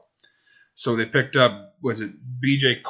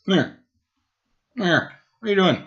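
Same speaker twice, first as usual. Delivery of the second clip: calling the dog; 8 semitones higher, faster than usual. Real, not acted. Calling the dog Hank over.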